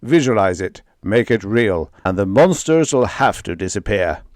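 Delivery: loud rising chant of Earth; rally the crew with a booming call; bellowing commands. Acted. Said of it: "he bellowed".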